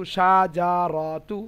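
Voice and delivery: সাজারতু stretched slowly with a rise; a slow, wrong example; wrong drawn-out way. Shown as a demonstration. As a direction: drags সাজারতু out too long. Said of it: "এরকম না".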